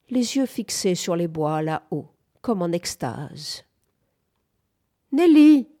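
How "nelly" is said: high, loud call of a name; piercing cry. A narrator's reading. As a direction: call out sharply to get her attention. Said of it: "cria Bamford d'une voix perçante".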